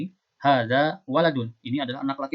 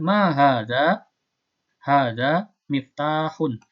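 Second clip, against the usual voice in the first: slow dictation; 3 dB louder, much slower than usual. Shown as a demonstration. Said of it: "Saya bacakan. Silakan ditulis".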